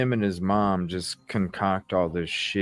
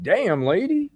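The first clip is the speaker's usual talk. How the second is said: exasperated exclamation; 11 semitones higher than usual. Retold or imitated. Exclaiming at the mom.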